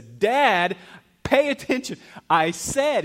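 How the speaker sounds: loud and high, demanding; a child yelling to be heard; insistent kid shout. Retold or imitated. Young son yelling at his dad for full attention.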